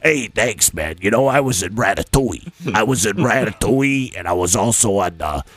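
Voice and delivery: low put-on Brooklyn accent, swaggering; Brooklyn driver voice. Friend imitating Vegas Phil greeting them, in a low Brooklyn accent. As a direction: play the pushy New York limo driver. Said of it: "you got a really funny voice".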